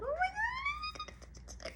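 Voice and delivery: tiny, very high, drawn-out squeal; baby-adoring coo. Acted. You see a child and melt, high soft coo. Cooing over an imagined child.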